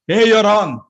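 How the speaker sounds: loud, blunt scolding of a student; harsh scolding. Shown as a demonstration. Teacher bluntly correcting his student, loud harsh scolding. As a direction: bark the correction, no gentleness. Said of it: "He doesn't say like that".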